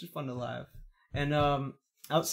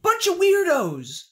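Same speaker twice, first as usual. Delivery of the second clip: high, loud exaggerated retort; hammed-up high-pitched scorn; mock outburst; 11 semitones higher, 5 dB louder than usual. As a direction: call it out in a high put-on voice.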